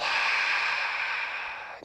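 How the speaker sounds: long airy sigh out; audible cleansing breath; demonstrated exhale. Shown as a demonstration. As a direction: breathe out fully through the mouth. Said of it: "Exhale through your mouth".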